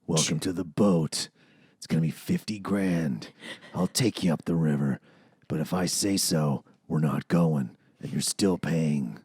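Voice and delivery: low, measured boat captain; Bill's deep voice. Retold or imitated. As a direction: drop way down, deliberate and smooth. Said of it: "he had such a deep voice".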